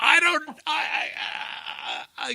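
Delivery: high, loud stuttering start; flustered stammer. Retold or imitated. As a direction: act out being lost for words. Acts out being lost for words.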